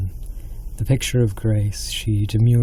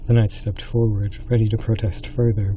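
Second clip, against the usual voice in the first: ordinary delivery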